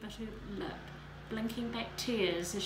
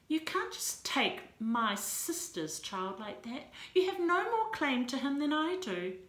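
defiant protest; 5 semitones higher, 5 dB louder than usual. A narrator's reading.

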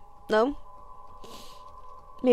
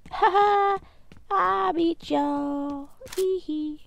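higher, slow teasing gloat; 6 semitones higher, slower than usual. Real, not acted. Gamer gloating over beaten enemies, higher teasing gloat. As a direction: rub it in with a giggle. Gloating after winning the fight.

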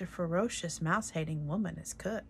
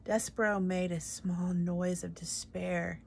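ordinary delivery

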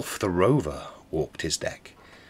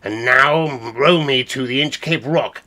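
commanding rover; 4 semitones higher, 8 dB louder than usual. Acted. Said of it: "Quoth he".

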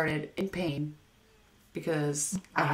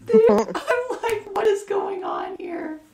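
laughing disbelief; 13 semitones higher, 7 dB louder, much slower than usual. Real, not acted. Retelling a bizarre scene, laughing.

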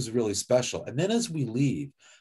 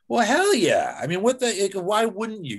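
put-on gruff old coach; 9 semitones higher, 4 dB louder than usual. Guest imitating Tommy on ordering a brushback pitch, mock indignant. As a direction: scoff like it's the most obvious thing in the world.

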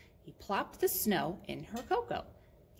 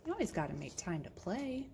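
ordinary delivery

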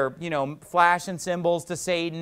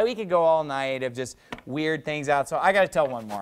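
normal talking voice

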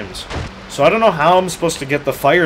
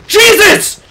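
high loud gasp then a yelp; startled gasp; 7 dB louder than usual. Real, not acted. Gamer jump-scared by a monster, startled yelp. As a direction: jump out of your skin.

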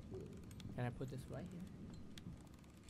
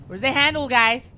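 loud, higher call to the team; 4 semitones higher, 9 dB louder than usual. Real, not acted. Player calling out loud to his team for the handle. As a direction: shout it across to your friends.

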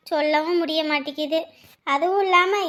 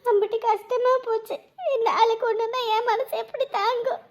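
higher-pitched, weepy lament; tearful; 4 semitones higher, 3 dB quieter than usual. Acted. Wife worried for her sick husband, crying. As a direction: cry through the words as you lament. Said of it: "எதுக்கு அழுதுட்டு இருக்கீங்க".

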